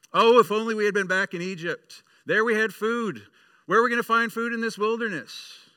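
high, loud whiny complaining; grumbling Israelites. Acted. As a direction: whine like hungry wanderers in the desert. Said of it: "their hearts began to grumble".

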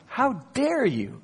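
high, drawn-out reproach; slow, indignant telling-off; strong scold. Retold or imitated. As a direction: scold slowly with a big swoop on DARE. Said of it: "It's a really, really strong scold".

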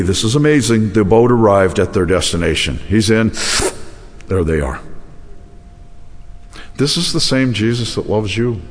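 ordinary talk, nothing marked

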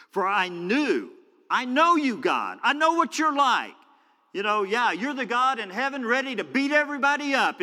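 loud, high outburst at God; Jonah not holding back. Acted. Jonah lets God have it over what he's like, angry shouting. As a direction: hurl an unrestrained accusation upward. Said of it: "He's not holding back anything".

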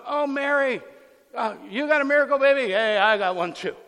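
loud, high, boastful mock brag; mock one-upping. Preacher mocks Elizabeth bragging about her miracle baby, hammed-up boast. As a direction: show off like a rival mom. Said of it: "Elizabeth isn't going".

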